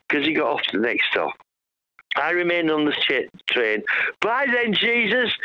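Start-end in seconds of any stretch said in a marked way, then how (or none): 4.2-5.3 a cheery farewell called out, pitched higher; calling out. Retold or imitated. Calling goodbye to the stranger leaving the train, called out. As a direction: call it after him as he gets off the train. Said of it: "I called out to him".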